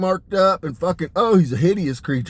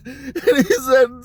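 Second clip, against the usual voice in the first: laughing; 7 semitones higher, much slower than usual. Real, not acted. Cracking up mid-story.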